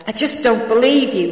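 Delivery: mildly fed-up reproach; exasperated. A narrator's reading. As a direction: scold a friend in disbelief. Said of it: "he added in an exasperated tone".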